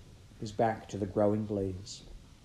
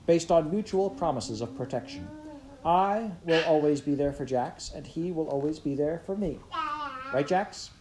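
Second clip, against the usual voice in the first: earnest big brother; 6 semitones higher than usual. A narrator's reading. Pickett promising Heather he will protect Jax, earnest. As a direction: swear it to your little brother. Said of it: "Pickett said".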